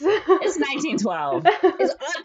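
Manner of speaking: high, loud, through laughter; laughing protest. Real, not acted. Storyteller answers a friend teasing "you're eight", laughing protest. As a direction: laugh while defending yourself.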